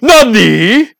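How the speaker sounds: loud, high startled Nani; shocked anime shout. Pretend. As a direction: yell it like a shocked anime hero. Anime quote shouted in banter.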